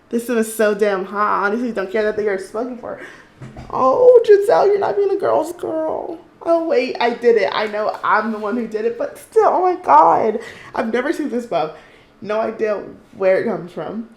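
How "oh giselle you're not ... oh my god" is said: loud, high-pitched exclaiming; scandalized outcry. Real, not acted. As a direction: cry out, appalled at her own character. Scolds her Sim, scandalized.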